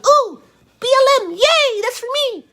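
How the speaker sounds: very high, silly squealing mock voice; mocking fan voice. Mocking BLM sticker buyers.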